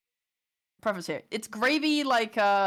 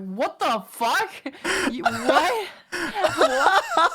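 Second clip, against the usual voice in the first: rising, incredulous "what the fuck", pitch climbing on the last "What?"; shocked disbelief; 7 semitones higher, 4 dB louder, much slower than usual. Retold or imitated. Baffled tourist to a rude local, incredulous. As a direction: act stunned, like you can't believe what you just heard.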